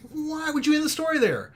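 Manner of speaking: high, loud exasperated cry; retold angry outburst. Angry reader yelling at the book's ending. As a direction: rant at the author for the ending. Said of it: "And I was so mad".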